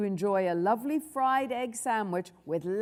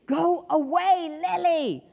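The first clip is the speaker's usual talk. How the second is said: higher, drawn-out angry order; irritated hiss; 7 semitones higher, 3 dB louder, slower than usual. A narrator's reading. Henry to clinging Lily, irritated order. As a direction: stretch out each word to get rid of a pest. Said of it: "hissed Henry".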